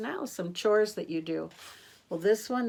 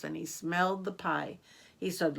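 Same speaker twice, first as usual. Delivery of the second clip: plain reading voice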